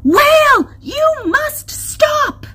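high, slow, forceful command; loud yell. A narrator's reading. Crystal orders the whale to stop, yelling. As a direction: yell each word out at full stretch. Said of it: "She yelled it so loud".

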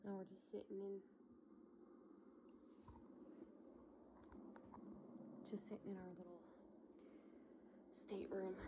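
whispering softly, not to wake anyone; quiet at night. Real, not acted. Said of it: "I'm talking kinda quiet so I don't wake up the kiddos".

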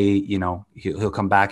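normal talking voice

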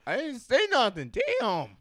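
loud, high defensive protest; defensive yell. Acted. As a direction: protest innocence loudly. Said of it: "It's like".

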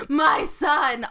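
loud, mock-anguished cry of "My son"; mock scream. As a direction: ham up a movie mother's scream. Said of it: "where she screams".

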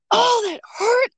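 high-pitched retold cry of pain; mad, hurt. Woman retelling hitting her hand on a frame, mad. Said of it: "I'm mad".

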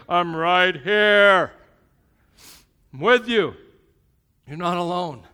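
huge drawn-out shout, each phrase on its own; screaming. Acted. God screaming at a distracted believer, full-voiced shout. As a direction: shout it like God trying to get through. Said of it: "He's screaming at you".

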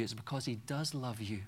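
usual speaking voice

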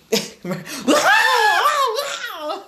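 imitated high, loud child's wail; mock dramatic wail. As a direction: wail like a melodramatic little girl. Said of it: "and you will think".

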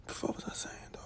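muttered whisper to himself; whispered aside. Real, not acted. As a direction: whisper it under your breath, lost in thought. Loses his train of thought.

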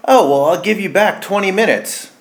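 high, bright mock-polite voice; mocking quote. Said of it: "One of my pet peeves is when people say".

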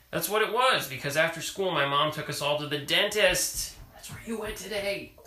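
reading a picture book aloud; story reading. Acted. Parent reading a picture book to child, storytelling voice. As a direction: read it to a child at bedtime.

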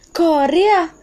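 loud, high startled outburst; shocked shout. Acted. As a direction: yell out in sudden shock. Shocked at being recorded.

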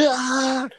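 sudden high yell at the deer; short, high, crazed yell; mock scream. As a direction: let out a wild, high shout to scare them off. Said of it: "started screaming at them".

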